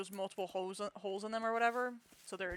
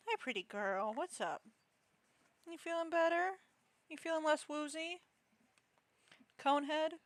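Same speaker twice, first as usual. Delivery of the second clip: higher, gently concerned voice for a pet; pet talk; 7 semitones higher than usual. Real, not acted. Owner asking her coned cat if it feels better, high and tender. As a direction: check on the sick cat tenderly.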